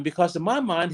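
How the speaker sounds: ordinary talk, nothing marked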